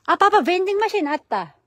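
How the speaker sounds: loud excited call across the street. Real, not acted. Vlogger calling to papa about the vending machine, loud and excited. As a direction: shout to papa that you found it.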